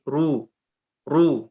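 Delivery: ru said thin, like Indonesian r. Shown as a demonstration. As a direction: let ru sound thin, the common mistake. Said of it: "Biasanya kita cuman gini".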